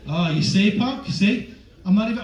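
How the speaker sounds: ordinary talk, nothing marked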